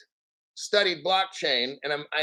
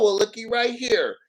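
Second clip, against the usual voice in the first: ordinary delivery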